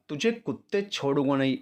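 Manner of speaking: usual speaking voice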